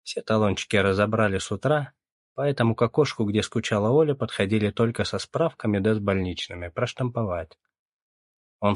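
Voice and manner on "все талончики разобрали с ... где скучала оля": ordinary delivery, nothing marked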